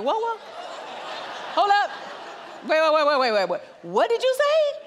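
high, rising alarm, laughing through the last question; startled. Retold or imitated. Mom stopping her brother mid-story, high and startled. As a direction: stop everything, you just heard something shocking. Mom hears son's secret date.

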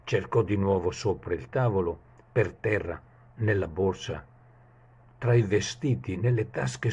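usual speaking voice

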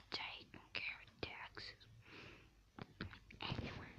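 hushed unvoiced aside; whispering. Real, not acted. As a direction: whisper it under your breath. Muttered aside to viewers.

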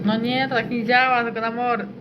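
normal talking voice

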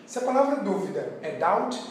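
ordinary delivery

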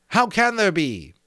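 high, loud character retort; exasperated storybook character; exasperated reply. A narrator's reading. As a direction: throw it back, pitched well up. Despairing reply in dialogue.

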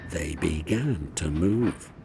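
ordinary delivery, nothing marked

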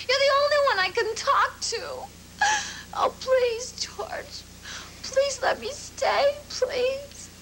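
crying plea trailing into a whispered name; tearful begging. Acted. To George, pleading to stay, tearful. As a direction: beg through tears to be let stay.

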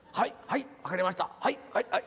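put-on high, staccato Japanese-accented acknowledgements; eager pupil answering in bursts, Japanese accent; Japanese guy. Pupil obediently answering his sensei, high Japanese accent. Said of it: "the Japanese guy says".

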